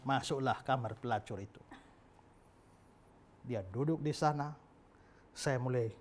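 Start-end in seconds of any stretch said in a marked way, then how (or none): none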